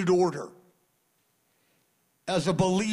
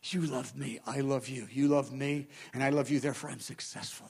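soft murmur; 6 semitones lower, 7 dB quieter than usual. Retold or imitated. Preacher in a tough spot murmuring love to God, softly. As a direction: lower and softer, a hushed prayer to God. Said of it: "I just whisper that to him".

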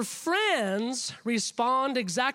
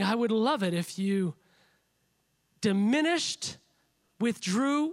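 ordinary talk

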